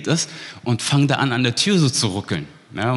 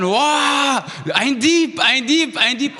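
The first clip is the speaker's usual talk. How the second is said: retold panicked scream; 12 semitones higher, slower than usual. Startled cousin screams at a thief in the dark, high frantic yell. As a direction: yell it like you've caught a burglar. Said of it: "fängt er zu schreien".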